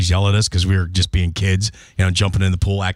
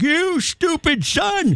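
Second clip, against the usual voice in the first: put-on high, slow cranky old man; much slower than usual. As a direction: shake your fist at the sky. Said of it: "your old man voice again".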